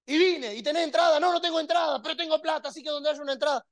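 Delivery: high, put-on voice of a fan who sold everything; imitated football fan. Preacher playing a fan telling the reporter he sold everything, high voice. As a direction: brag it like a devoted fan.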